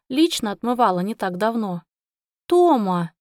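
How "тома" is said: one-word scolding call; chiding a friend by name; reproachful exclamation. A narrator's reading. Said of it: "укоризненно воскликнула я".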